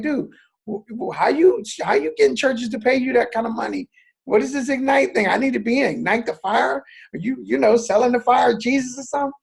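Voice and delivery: mock disbelief, tease him like a fellow Marine; incredulous teasing. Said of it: "And I was like".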